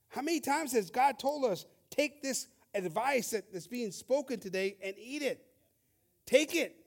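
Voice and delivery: high, loud exhortation; fiery preaching. Acted. Preacher urging the congregation to take God's advice, loud and fiery. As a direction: drive the point home at full force.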